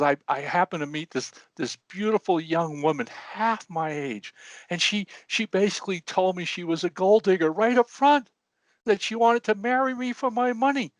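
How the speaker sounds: high, blubbering old man, hammed-up; old man mock-weeping his story, pitched high; crying old man. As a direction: tell it through mock tears in a high voice. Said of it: "And he's crying".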